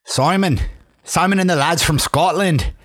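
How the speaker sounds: lightly voiced Scottish accent; a hint of a Scottish lad. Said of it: "pretty good Scottish accent there".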